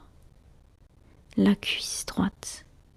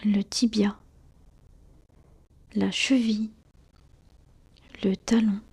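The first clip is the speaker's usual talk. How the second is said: ordinary delivery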